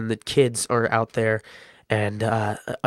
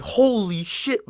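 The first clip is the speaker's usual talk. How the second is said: shocked holy shit; 9 semitones higher, much slower than usual. Retold or imitated. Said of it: "Martinez is like".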